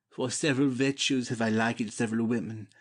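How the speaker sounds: ordinary talk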